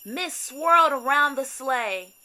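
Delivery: plain reading voice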